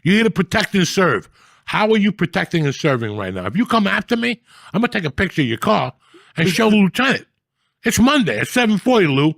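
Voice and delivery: loud, high furious tirade; reenacted rant. Reenacting yelling at the cop, furious. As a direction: tear into the cop full force.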